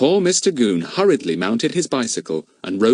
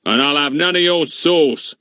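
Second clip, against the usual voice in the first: majestic; 6 semitones higher, 4 dB louder than usual. Acted. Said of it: "said Mister Goon majestically".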